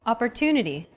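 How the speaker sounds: "Opportunity" with TU stressed and held longer. Shown as a demonstration. Teacher alternates English with Arabic example, TU stressed in "Opportunity". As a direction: lean on the TU in "Opportunity". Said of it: "The stressed syllable to in opportunity".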